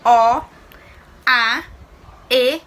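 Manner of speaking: teacher drilling "O", "A", "E" as separate loud letters; listing endings emphatically. Shown as a demonstration. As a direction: hit "O", "A", "E" one at a time for the class. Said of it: "quando elas são terminadas em".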